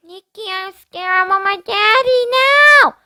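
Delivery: little sister whining in fear; whining little girl. Acted. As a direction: whine for Daddy like a frightened kid. Brianna voiced.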